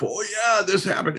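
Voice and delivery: high, eager mock excitement; hammed-up wide-eyed enthusiasm; excited exclamation. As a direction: play it up like a delighted kid. Telling staff about church connections.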